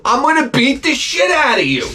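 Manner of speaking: high-pitched yell, held high to the end; comic shouted threat. Acted. Acted threat of a beating.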